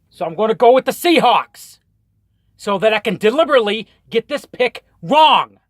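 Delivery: riled up, selling the reverse-jinx plan; worked-up rant. Real, not acted. Explains deliberately wrong pick, worked up.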